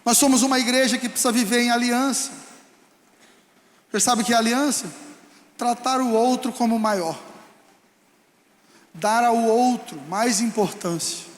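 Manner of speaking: ordinary talk, nothing marked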